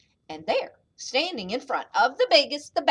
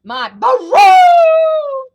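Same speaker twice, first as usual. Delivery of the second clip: high, very loud trumpeting boast; slow booming elephant brag; shouting elephant; 8 semitones higher, 16 dB louder, slower than usual. A narrator's reading. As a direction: shout it, stretching out the baroo. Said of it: "shouted the elephant".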